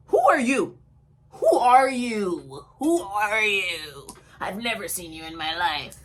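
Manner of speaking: slow, incredulous confrontation; indignant challenge. Retold or imitated. Confronting strangers in retold quarrel.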